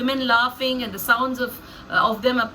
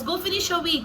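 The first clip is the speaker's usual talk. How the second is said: high, offhand dismissal; casual dismissal; 7 semitones higher, faster than usual. Acted. Laban brushing off cheated Jacob, casual and unbothered. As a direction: toss it off with no remorse. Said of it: "he's very casual about what he says".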